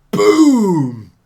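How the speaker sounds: loud mock impact boom; shouted chop boom. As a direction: blast out the sound of the chop. Imitating the chop hitting.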